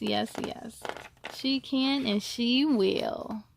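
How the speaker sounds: ordinary delivery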